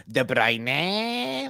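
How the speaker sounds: De Bruyne stretched to "de bry-NAY"; mocking mispronunciation. Imitating the fifa commentator, mocking mispronunciation. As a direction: drag out the last syllable of De Bruyne as "neh". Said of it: "the commentator says it wrong".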